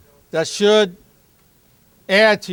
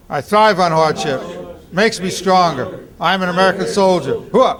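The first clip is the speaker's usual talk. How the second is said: loud rhythmic drill chant; loud gung-ho soldier; 4 dB louder than usual. Shown as a demonstration. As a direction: chant it hard during push-ups. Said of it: "start saying it with me".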